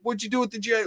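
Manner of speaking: mild imitated outrage; retold shock. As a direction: act the betrayed kid. Retold confronting brother.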